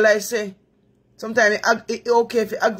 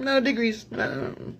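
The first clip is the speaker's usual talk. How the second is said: ordinary delivery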